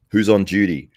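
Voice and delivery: ordinary talk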